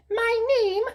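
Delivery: very high, prim bird voice; Sylvia the bird. Acted. Haughty bird correcting Rapunzel, very high voice. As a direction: squeak it out like a haughty little bird. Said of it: "she replied".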